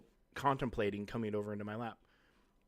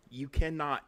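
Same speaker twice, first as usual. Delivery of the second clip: raised, firm no to the dog; louder firm warning; firm scold; 4 semitones higher, 6 dB louder, slower than usual. Real, not acted. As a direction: stop the dog in its tracks. Scolding dog off his lap.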